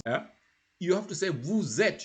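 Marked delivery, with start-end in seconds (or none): 1.3-2.0 VOUS ÊTES linked with a z; liaison. Shown as a demonstration. Teacher shows second person VOUS ÊTES, liaison. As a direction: join VOUS onto ÊTES through a z. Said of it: "you have to join".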